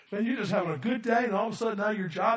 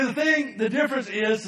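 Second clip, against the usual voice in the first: normal talking voice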